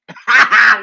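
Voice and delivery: sudden loud high laugh; loud burst of laughter. Real, not acted. Laughs at her typo.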